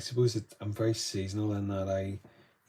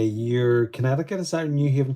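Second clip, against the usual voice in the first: ordinary talk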